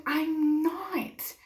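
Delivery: high, pleading mock yell; retold scream. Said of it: "screaming at the top of my lungs".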